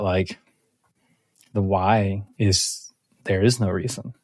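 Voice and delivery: usual speaking voice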